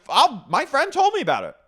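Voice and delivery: very high, loud retort; mock defensive outburst. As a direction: yell it back as an impression of someone cornered. Acting out argument, raised voice.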